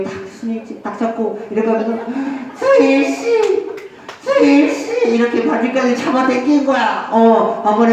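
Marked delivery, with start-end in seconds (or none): 2.6-3.7 high, pleading call to a lover; plaintive plea. Shown as a demonstration. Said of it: "애절하게 해야지". 4.2-5.1 plaintive plea. Shown as a demonstration. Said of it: "애절하게 해야지".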